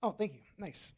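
ordinary talk

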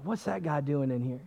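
low, very soft gossip about an outsider; hushed snide onlooker. Retold or imitated. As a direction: imitate people talking about someone behind their back. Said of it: "I'd hear people snickering".

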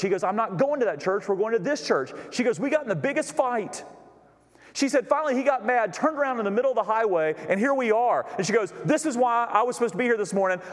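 lightly voiced woman, high and urgent; agitated woman. Heather tells the pastor why she came, urgently. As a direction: tumble it out like she can't believe it. Heather retells her fight.

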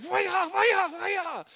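high choked mock sobs; sobbing gasps. Kid too angry to talk, high choked mock sobs. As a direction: gasp through mad tears. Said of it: "And I'm just like".

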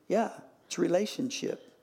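ordinary talk

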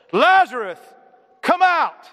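high, loud call to the dead; loud commanding shout. Acted. Jesus at the tomb of Lazarus, loud shout. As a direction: shout it out with authority. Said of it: "he shouted in a loud voice".

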